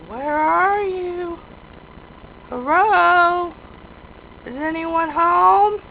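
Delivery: high, slow, stretched-out call. Pretend. Visitor checking whether anyone is home, slow high calling. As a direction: draw each word out high, seeking an answer.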